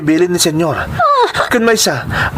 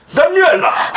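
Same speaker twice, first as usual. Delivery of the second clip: a much higher call after her as she leaves; 11 semitones higher, slower than usual. Acted. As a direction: shout her name to stop her going. Father calls daughter back.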